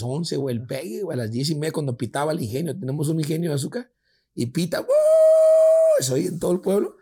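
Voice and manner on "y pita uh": long high hooted whistle; mill whistle imitation. Telling a friend how the mill whistle blows, long high hoot. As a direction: mimic the factory whistle blowing.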